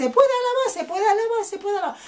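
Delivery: high mocking chant of churchgoers; mimicking churchgoers. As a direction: parody the pious refrain. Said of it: "mucha gente en las iglesias dice".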